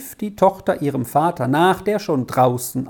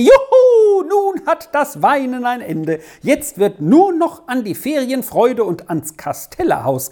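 very high, loud whoop with a laugh; boy cheering at the good news; joyful shout; 10 semitones higher, 3 dB louder than usual. A narrator's reading. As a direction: cheer as the cap flies up. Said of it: "warf er seine Mütze hoch in die Luft und schrie".

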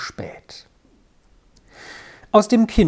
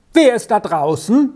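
loud call; 4 semitones higher, 3 dB louder than usual. A narrator's reading. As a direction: call toward the door, raised and higher. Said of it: "mit lautem Ruf nach der Tür hin".

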